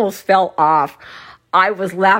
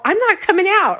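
high-pitched retold protest; refuse, pitched right up; adamant refusal; 11 semitones higher than usual. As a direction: dig your heels in, high-voiced. Retold refusal in restroom quarrel.

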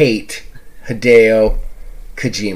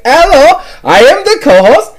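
high, mock German co-host; German accent; 9 semitones higher, 4 dB louder than usual. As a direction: imitate her with a hammy German accent. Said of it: "she definitely had a German accent".

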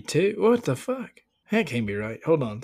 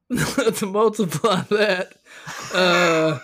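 laughing while talking; 7 semitones higher, much slower than usual. Real, not acted. Laughing through failed math.

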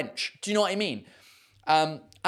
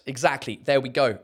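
ordinary delivery

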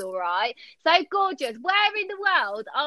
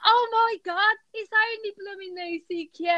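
mock astonishment; 7 semitones higher than usual. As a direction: act out a sudden delighted recognition. Acting out her sudden realisation.

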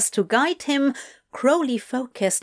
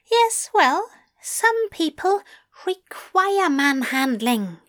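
much higher, playful angel voice; Aziraphale's voice; 7 semitones higher than usual. Acted. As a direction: pitch him up, smug and teasing.

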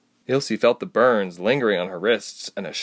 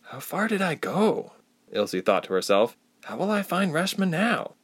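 anxious thought; 8 semitones higher than usual. A narrator's reading. Lost, thinking to herself.

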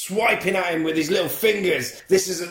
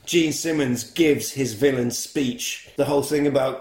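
usual speaking voice

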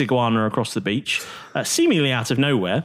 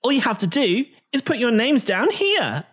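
cutesy hostess voice; 7 semitones higher than usual. Acted. Aasimar hostess explains competition.

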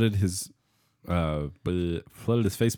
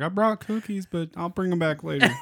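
high neighbor voice; 12 semitones higher than usual. Pretend. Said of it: "Your neighbor's like".

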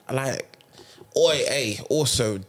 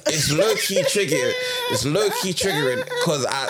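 laughing while talking, pitched up; 10 semitones higher, 4 dB louder than usual. Real, not acted. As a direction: laugh through the words. Laughing about being checked on.

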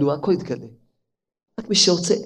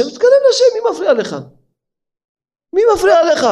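rousing challenge. Real, not acted. Preacher challenging the listener.

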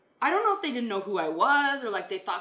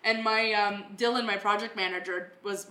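ordinary talk

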